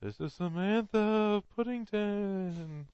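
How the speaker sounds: slow, high, hammed-up phantom; ghost voice. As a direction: stretch each word out like a spectral lady. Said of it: "friends on the other side of the gravestone".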